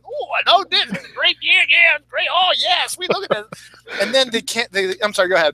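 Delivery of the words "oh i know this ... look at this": high, lounging, self-satisfied Odin impression; imitating Anthony Hopkins lolling on his couch, pleased with the show; lazy Odin. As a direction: purr it out like a pampered king. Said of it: "he's sitting there going".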